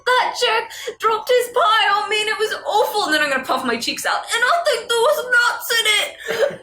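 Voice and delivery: high, squeaky voice, laughing and fake crying; high fake-sobbing complaint over a ruined pie; whiny victim. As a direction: whine like a wronged little critter. Player voices pie-covered NPC.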